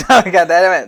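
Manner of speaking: usual speaking voice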